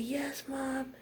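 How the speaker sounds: high, soft reply to mom; quiet answer. Retold or imitated. Girl hiding her crush answers mom, quietly. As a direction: keep it small and hushed. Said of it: "And I'm real quiet".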